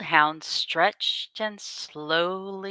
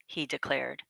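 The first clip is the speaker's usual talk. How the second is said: plain reading voice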